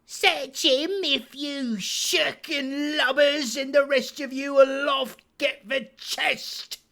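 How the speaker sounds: high, loud scolding command; angry cry. Acted. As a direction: curse them into action. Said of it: "he cried".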